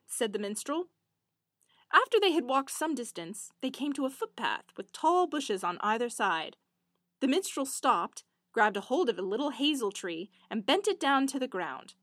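usual speaking voice

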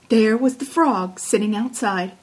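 ordinary delivery

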